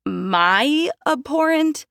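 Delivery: slow, high disbelieving echo; incredulous. Acted. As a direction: repeat the insult in shock. Outraged echo of insult.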